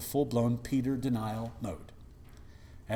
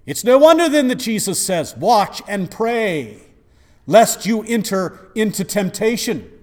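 preaching at full force; 10 semitones higher, 15 dB louder than usual. Acted. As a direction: preach it like a revival climax. Preaching Jesus' warning.

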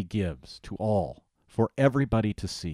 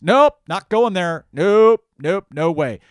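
much higher and louder, slow and stubborn; old Adam refusing; 10 semitones higher, 12 dB louder, slower than usual. Retold or imitated. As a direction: shout a flat refusal like a sulky sinner. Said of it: "guess what the old Adam says".

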